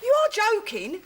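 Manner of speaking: high, loud scoffing disbelief; scornful outburst. Acted. Friend mocking the idea of calling police, loud scoff.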